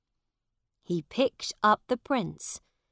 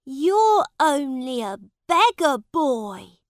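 mocking schoolboys; 8 semitones higher, 10 dB louder than usual. Schoolboys mock the beggar prince.

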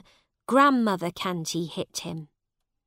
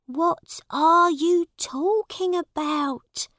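high, slow, louder question; worried mother; 9 semitones higher, 5 dB louder than usual. A narrator's reading. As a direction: voice a mother puzzled by her son. Worried mother questions her son.

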